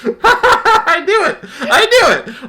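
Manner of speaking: high laughing I knew it. Real, not acted. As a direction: laugh through the words, high and loud. Laughing after the word landed.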